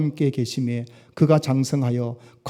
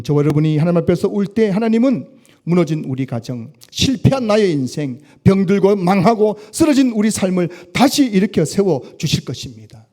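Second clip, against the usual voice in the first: rising, louder sung-out preaching; fervent preaching; 4 semitones higher, 5 dB louder than usual. Acted. Pastor promising his flock restoration, preaching fervently. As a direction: lift the promise into a near chant.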